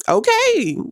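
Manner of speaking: squeaky high startled okay; a jolted, high yelp of okay; high surprised okay, caught off guard. Real, not acted. Reacting to God's nudge.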